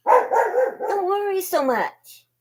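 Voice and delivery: calm the anxious pup gently; soothing dog talk. Real, not acted. Streamer soothing a worried dog, high and slow.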